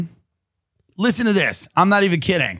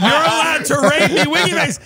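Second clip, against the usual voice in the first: higher and louder, laughing through the words; 7 semitones higher, 4 dB louder than usual. Retold or imitated. Insisting to the co-host that he sent the text, laughing. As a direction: read his text back while cracking up. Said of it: "Swear to God".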